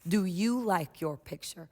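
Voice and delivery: put-on slow, soft, inviting question to a child; teacher gently asking a child about their painting; gentle question. Shown as a demonstration. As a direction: ask it kindly, slowly, giving the child time. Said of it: "I will say gently".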